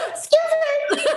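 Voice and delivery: very high, loud "Excuse" breaking into a laugh; laughing indignant protest. Retold or imitated. Retelling the weigh-in to the hosts, high loud laughing protest. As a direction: shout it up high while laughing. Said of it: "I found my voice".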